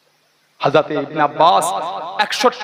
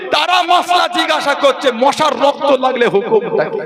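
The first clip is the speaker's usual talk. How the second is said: high-pitched imitated lament, building in intensity; retold tearful outcry; 6 semitones higher than usual. Companion decries those who martyred the Prophet's descendant, acted tearful outcry. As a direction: voice a mounting, pained protest, lightly acted. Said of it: "চোখ ভরা পানি এলে কাঁদছেন আর বলছেন".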